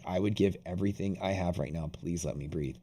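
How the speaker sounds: hushed retold plea to breathe; quiet pleading thought. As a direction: plead under your breath. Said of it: "I was like".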